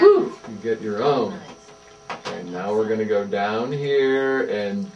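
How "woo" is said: excited whoop. Real, not acted. Cheering the child's recording.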